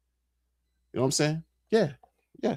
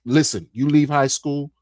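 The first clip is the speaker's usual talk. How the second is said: ordinary talk, nothing marked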